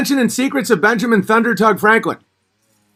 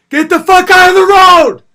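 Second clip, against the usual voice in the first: far higher and louder furious shout; angry yell; 12 semitones higher, 9 dB louder than usual. Retold or imitated. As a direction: scream at cyclists from a passing truck. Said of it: "my brother'd be like".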